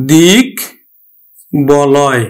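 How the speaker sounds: দিক and বলয় set apart with pauses, ব fully sounded; syllable by syllable. Shown as a demonstration. Teacher sounding out দিক বলয় for students. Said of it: "আমরা উচ্চারণ করব".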